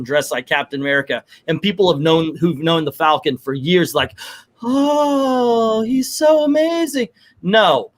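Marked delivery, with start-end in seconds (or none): 4.6-7.1 high, breathy mock adoration; mock gushing. Mocking people who gush over Falcon in the suit, high fawning. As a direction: gush like a starstruck fan, sarcastically.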